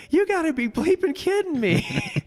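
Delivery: very high, disbelieving cry; mock outrage. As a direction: shriek it in comic shock. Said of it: "he's like".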